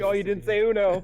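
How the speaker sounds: teasing gotcha. Pretend. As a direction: rub it in with glee. Mock gotcha quote.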